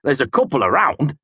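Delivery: a tipsy man setting him straight; drunken amused mercenary. Acted.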